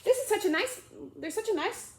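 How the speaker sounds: high, bright gushing voice; excited chatter. Acted. Alice gushing to the mouse about a dog, excited. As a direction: gush about the little dog.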